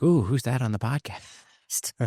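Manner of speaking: slow, drawn-out mock-intrigued wife voice; imitating swooning wife. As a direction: play the wife cooing with interest at a voice. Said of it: "because she'd be like".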